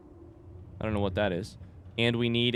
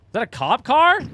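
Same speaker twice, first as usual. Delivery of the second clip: surprised; 13 semitones higher, 10 dB louder than usual. Real, not acted. Spots a cop car in game.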